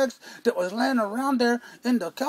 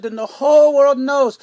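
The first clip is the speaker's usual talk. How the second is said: louder mock sermon line; a lightly voiced preacher, louder; preacher proclaiming; 9 dB louder than usual. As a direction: boom it out like a preacher. Preacher quoted proclaiming God.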